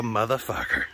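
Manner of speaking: ordinary delivery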